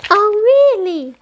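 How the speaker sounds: put-on exasperated little girl's outcry; frustrated. A narrator's reading. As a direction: burst out in frustration. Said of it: "couldn't contain her frustration".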